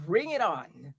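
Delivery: loud, eager challenge; loud dare. Acted. Partner accepts a challenge in bed, loud and eager. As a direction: throw it out as a rousing dare.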